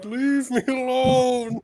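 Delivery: high, loud, drawn-out character scream; mock screaming. Lonka screams at the psychic voice, hammed-up scream. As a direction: scream it slowly, stretching every word in play. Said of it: "Lonka starts screaming".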